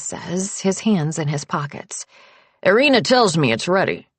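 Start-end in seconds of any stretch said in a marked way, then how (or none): 2.6-4.1 lightly voiced older man, dry; a hint of a blunt old patriarch teasing; gruff old father. Old patriarch teasing his wife about the guest, lightly gruff.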